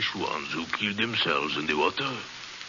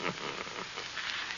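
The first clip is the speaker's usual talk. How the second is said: low, breathy madman's chuckle; soft chuckle; 6 semitones lower, 15 dB quieter than usual. Acted. Madman gloats over his captive, soft chuckle. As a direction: chuckle softly to yourself.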